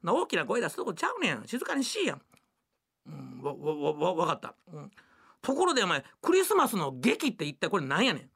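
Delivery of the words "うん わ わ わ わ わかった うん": mock tearful. Husband scolded, meekly agrees.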